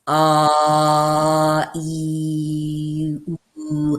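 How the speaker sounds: long held vowels on a level pitch; sustained vowel exercise. Shown as a demonstration. Voice teacher demonstrates vowel exercise to student, sustained vowels. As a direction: draw out each vowel steadily, like a voice drill. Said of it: "vowel sounds like".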